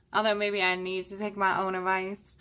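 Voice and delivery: normal talking voice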